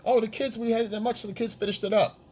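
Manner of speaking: high, offhand explanation; light high mock excuse; girlfriend voice. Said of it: "And you get a sandwich".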